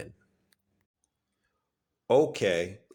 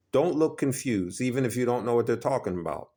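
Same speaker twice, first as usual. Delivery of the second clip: usual speaking voice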